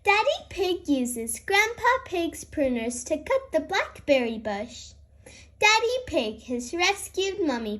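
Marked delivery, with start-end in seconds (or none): none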